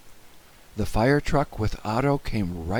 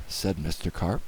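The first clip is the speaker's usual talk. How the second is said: ordinary delivery, nothing marked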